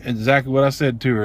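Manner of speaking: ordinary delivery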